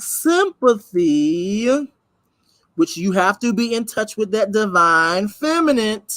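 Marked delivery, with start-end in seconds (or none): 0.0-1.9 Sympathy stretched very slowly, syllable by syllable; repeating the key word slowly for the audience; drawn-out sympathy. Shown as a demonstration. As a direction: spell out Sympathy slowly as a point of emphasis. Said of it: "His what?".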